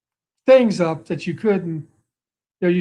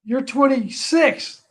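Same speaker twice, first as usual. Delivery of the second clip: coach calling out; 6 semitones higher than usual. Retold or imitated. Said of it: "he says".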